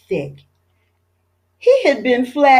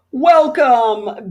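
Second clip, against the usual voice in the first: bellowing welcome; 4 semitones higher, 4 dB louder than usual. A narrator's reading. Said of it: "bellowed Chief Abbott".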